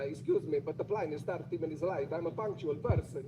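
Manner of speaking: put-on Italian-accented English. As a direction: imitate a polite complaint in an Italian accent. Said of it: "I'd try it in an Italian accent".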